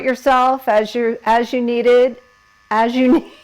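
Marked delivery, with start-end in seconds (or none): 2.7-3.3 laughing mid-word. Real, not acted. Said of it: "I can't even talk while I'm doing this".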